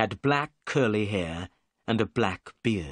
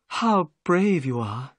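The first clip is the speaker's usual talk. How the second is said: admiring; 5 semitones higher, slower than usual. A narrator's reading. Miss Morstan admires.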